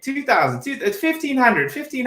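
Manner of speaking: high, rushed auctioneer call; bad auctioneer. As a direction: bungle the bids like an auctioneer. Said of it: "Can you get an auctioneer voice going?".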